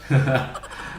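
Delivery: short unvoiced breathy laugh. Real, not acted. Laughing at the boss's curt thanks, a short breathy laugh. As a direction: laugh under the breath, amused.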